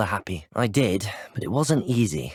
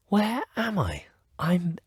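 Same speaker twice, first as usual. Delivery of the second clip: higher-pitched, disoriented question; bewildered; 8 semitones higher, slower than usual. Acted. Student suddenly lands in an unknown place, bewildered. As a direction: ask it high, lost and confused. Character finds self somewhere unknown.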